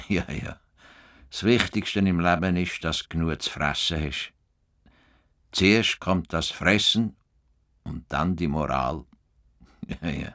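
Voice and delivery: lonely man explaining the world to his cow, tender; tender musing to a cow. Acted. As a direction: talk gently to Rosa as if she understands. Muses softly to his cow.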